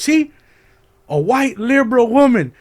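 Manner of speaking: high, loud mock denunciation; imitated ranting shout. Mother pointing out a heckler, mock high yelling. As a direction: yell it as an outraged accusation.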